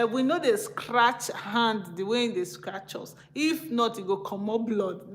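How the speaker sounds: proverb in light Nigerian pidgin; a hint of Nigerian pidgin, half laughing. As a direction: say it as the pidgin saying from Benin City. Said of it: "That's how pidgin English we say".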